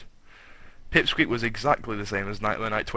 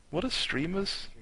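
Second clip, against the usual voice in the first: "streamers" asked much higher in disbelief; incredulous repeat; 9 semitones higher, 5 dB quieter than usual. Shown as a demonstration. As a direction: ongelovig hoog herhaald "What are streamers?".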